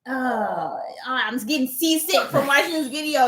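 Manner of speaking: high, whiny imitation of a viewer; mock complaining commenter. Creator imitates a seasick viewer's comment, mock complaint. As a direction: groan it like a queasy complainer. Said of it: "the comments were just filled with".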